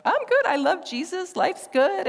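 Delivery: high, loud beaming voice; bubbling over with joy; giddy happy. Retold or imitated. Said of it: "I'm like".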